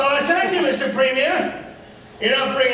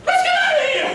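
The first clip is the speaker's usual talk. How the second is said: panicked shout; faster than usual. Acted. Panicked cry to flee.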